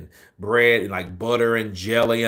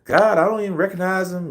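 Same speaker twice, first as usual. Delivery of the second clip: much higher, mocking squeaky coworker voice; imitating Melissa; 10 semitones higher than usual. Coworker mocked for not knowing a famous customer, high mocking voice. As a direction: mimic her high-pitched annoying voice. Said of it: "she had this high-pitched voice".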